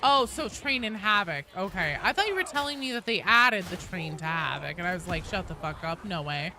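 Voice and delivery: usual speaking voice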